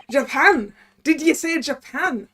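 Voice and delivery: put-on Scottish accent. Governor hears visitor is from Japan, Scottish accent. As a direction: demand it in disbelief, Scottish accent. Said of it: "okay, we gotta do Scottish".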